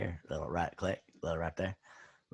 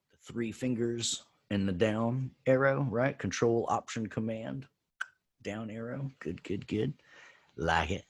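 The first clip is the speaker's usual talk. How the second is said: ordinary talk, nothing marked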